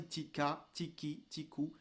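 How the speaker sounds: "tika, tiki, tikou" with a vowel after each K; wrong added vowels. Shown as a demonstration. Teacher contrasting with tic, wrong added vowels. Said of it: "j'ai pas dit".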